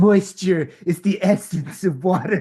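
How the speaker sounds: put-on pretentious perfume-ad voice; Zoolander. Model in a perfume commercial, mock-profound. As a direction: deliver it with mock profundity. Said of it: "like Zoolander, where it's like".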